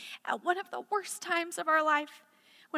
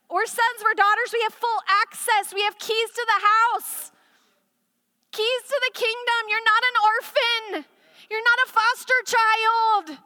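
higher, louder, building declaration of belonging; preaching at full force; 8 semitones higher, 7 dB louder than usual. Acted. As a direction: proclaim it to the congregation.

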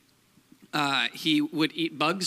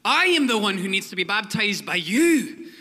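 loud, raised Scottish proclamation; John the Baptist, Scottish accent; 5 semitones higher, 6 dB louder than usual. Acted. John protesting to Jesus at baptism, loud Scottish accent. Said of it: "sorry, I'll do Scottish".

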